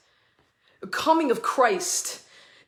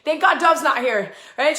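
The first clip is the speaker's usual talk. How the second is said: ordinary talk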